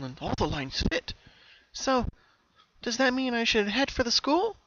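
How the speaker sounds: high, bright pony voice; Pinky voice. Acted. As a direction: lift into a young mare's puzzled voice. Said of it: "she asked herself".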